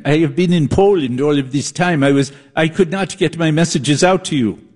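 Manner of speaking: hammed-up foreign-film survivor voice; serious drama survivor. Long-lost survivor explains absence from Poland, mock-serious drama voice. Acted reply in Berlin drama parody.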